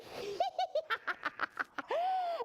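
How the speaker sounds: very high peal of giggles; gleeful giggle. A narrator's reading. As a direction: giggle wildly like a silly duck. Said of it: "giggled out with glee".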